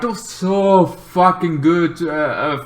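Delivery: ordinary talk, nothing marked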